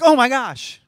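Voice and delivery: Oh my gosh thrown high and loud in fake surprise; mock shock. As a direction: overdo the astonishment on Oh my gosh. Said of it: "It's not like he's like".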